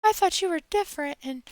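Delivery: a hint of a disappointed follower; mock hurt follower. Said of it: "just was like".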